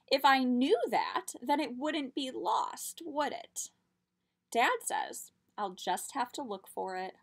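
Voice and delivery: lively storybook read-aloud; reading to children. A narrator's reading. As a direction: tell it like a picture book. Reads picture book aloud.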